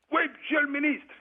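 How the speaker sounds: high, clipped soldier's reply to a superior; soldier answering. Retold or imitated. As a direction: bark it up high like a para at attention. Para answers minister.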